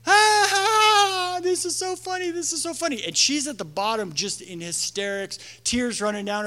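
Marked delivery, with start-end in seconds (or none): 0.1-3.0 high giggly mock laughter; giggling kids. Kids on the pile, high giggling. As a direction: imitate carefree kids laughing. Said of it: "think it's a game".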